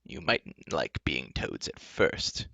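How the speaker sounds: low, very slow, measured voice; reasonable enchantress. Acted. Enchantress persuading her captives, reasonable. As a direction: reason with them slowly and low. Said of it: "the enchantress said reasonably".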